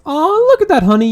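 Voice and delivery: ordinary delivery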